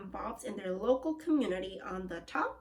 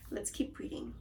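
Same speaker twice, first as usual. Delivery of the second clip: whispered aside; 9 dB quieter than usual. Real, not acted. Quiet aside after comment.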